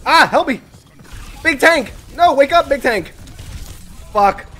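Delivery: high, loud frantic begging; panicked pleas. Real, not acted. Healer under attack begging the tank for help. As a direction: plead for rescue, near panic. Being attacked, begs teammate.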